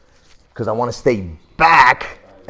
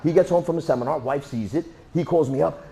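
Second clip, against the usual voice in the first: ordinary delivery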